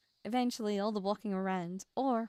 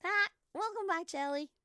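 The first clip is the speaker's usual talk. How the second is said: cheery greeting; 8 semitones higher, 4 dB louder than usual. Pretend. Greeting character.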